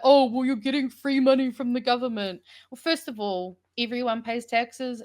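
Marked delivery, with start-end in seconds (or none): 0.0-2.4 mocking quote. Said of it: "People love to be like".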